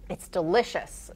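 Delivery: ordinary delivery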